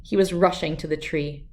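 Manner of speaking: plain reading voice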